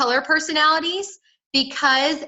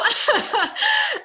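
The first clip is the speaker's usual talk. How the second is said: real high-pitched laugh; 13 semitones higher than usual. Real, not acted. Laughs while setting up demo.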